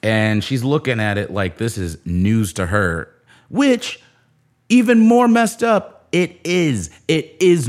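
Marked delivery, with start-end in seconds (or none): 3.5-7.7 incredulous. Real, not acted.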